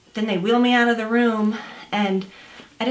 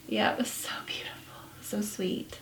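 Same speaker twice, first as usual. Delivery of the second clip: hushed, slightly quick whisper; 11 dB quieter than usual. Real, not acted. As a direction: voice falls to a moved whisper. Recalling husband holding newborn.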